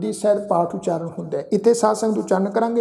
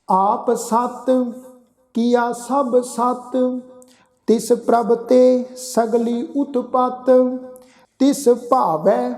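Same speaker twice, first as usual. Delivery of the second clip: slow, drawn-out sacred recitation; measured Gurbani reading, sustained line endings; scripture recitation; 3 semitones higher, much slower than usual. Shown as a demonstration. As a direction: chant each phrase slowly with long held vowels.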